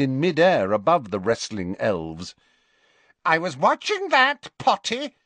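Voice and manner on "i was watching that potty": indignant spirit robbed of his show; indignant Peeves. Acted. Peeves protesting to Harry over the fight. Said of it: "he told Harry indignantly".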